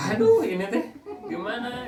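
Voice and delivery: high, laughing "aduh"; preacher laughing at his own story; laughing exasperation. Real, not acted. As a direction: laugh through the mock despair. Laughing at being sent away.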